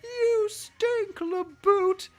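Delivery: put-on squeaky old geezer yelling an insult; Grandpa Simpson yell. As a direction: bellow it like a grumpy old man heckling. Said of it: "and he's like".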